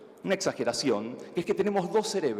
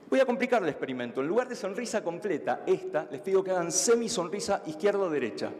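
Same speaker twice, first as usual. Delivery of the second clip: usual speaking voice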